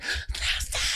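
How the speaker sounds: soft, voiceless begging; mock panicked plea. Girl about to cry over the police call, whispered mock panic.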